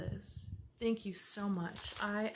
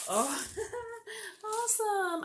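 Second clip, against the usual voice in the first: excited gasp; 12 semitones higher than usual. Real, not acted. Excited gasp opening gift.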